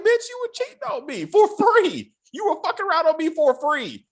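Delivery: high, mocking comeback; mock angry husband. Husband telling off his cheating wife, mock fury. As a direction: throw it back in her face, hammed up. Husband voiced snapping back.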